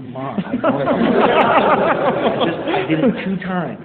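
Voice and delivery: high, loud, laughing protest to mom. Retold or imitated. Son protesting to his mom, laughing high and loud. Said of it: "And I'm like".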